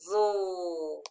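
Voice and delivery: "zool" drawn out, falling; long "zool" sinking in pitch; isolated final syllable. Shown as a demonstration. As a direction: stretch "zool" as one lone syllable. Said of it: "the final stable syllable".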